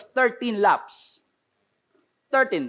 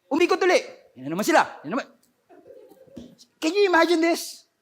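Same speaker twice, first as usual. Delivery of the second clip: ordinary talk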